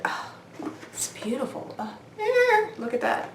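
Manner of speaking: hushed, admiring oohs and sighs; oohing and aahing. Real, not acted. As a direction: ooh and aah softly in admiration. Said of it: "quit oohing and aahing".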